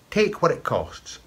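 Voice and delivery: careless offer. Acted. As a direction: toss it off like a carefree lad. Jamie hands over his purse.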